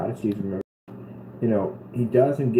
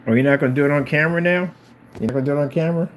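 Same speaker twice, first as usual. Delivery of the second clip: teasing pet; 5 semitones higher, 7 dB louder, faster than usual. Real, not acted. Teasing the cat on camera.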